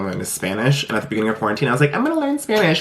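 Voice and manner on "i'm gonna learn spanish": very high, chirpy imitation of yourself; pitched-up retold enthusiasm; mock eager resolve. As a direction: announce a big plan with mock enthusiasm.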